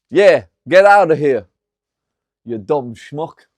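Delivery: put-on loud Italian mobster accent, slowing on dumb schmuck. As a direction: bark it out in a thick Italian accent, then drag the insult. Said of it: "What do you think of my Italian accent?".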